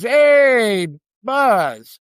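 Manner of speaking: high, loud, drawn-out "hey" on greeting; hearty phone greeting. Retold or imitated. Casino man greets the young clerk by phone, hearty. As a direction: greet a new contact heartily, voice lifted.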